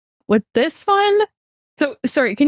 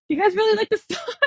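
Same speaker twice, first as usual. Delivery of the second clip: higher-pitched, hopeful checking; needy mom; 7 semitones higher, 3 dB quieter than usual. Retold or imitated. Mom rechecking with the family, needy and hopeful. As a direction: imitate a mom fishing for praise.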